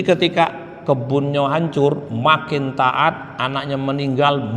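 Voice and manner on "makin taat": slow refrain. Real, not acted. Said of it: "repeated refrain, slowed".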